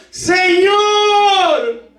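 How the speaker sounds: high, crying plea of a sinking man; Peter crying out. Retold or imitated. Peter sinking, crying out to the Lord, high and pleading. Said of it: "gritó, diciendo".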